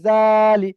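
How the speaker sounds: "জালি" with a long jaa and short li; drawn-out syllable. Shown as a demonstration. Teacher spells it again, jaa of "জালি" held long. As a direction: stretch the first syllable of "জালি" firmly. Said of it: "জাটাকে আমাকে টান করতে হবে".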